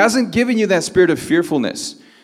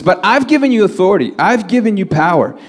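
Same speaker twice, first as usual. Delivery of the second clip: high-pitched, insistent preaching; emphatic assurance; 5 semitones higher than usual. Acted. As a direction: press the promise home with raised pitch. Preacher voices Jesus empowering disciples.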